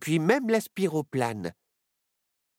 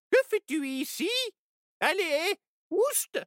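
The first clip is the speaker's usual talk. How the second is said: much higher, cackly witch; sharp high witch; 13 semitones higher than usual. Acted. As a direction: shoo it away like a witch. Witch shoos squirrel.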